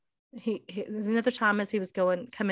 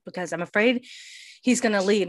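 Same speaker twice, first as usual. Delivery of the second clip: normal talking voice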